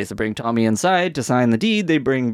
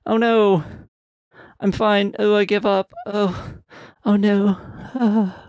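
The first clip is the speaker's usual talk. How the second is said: higher, hammed-up fake defeat; fake surrender; 5 semitones higher, slower than usual. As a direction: whine like a villain faking defeat. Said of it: "Billy's like".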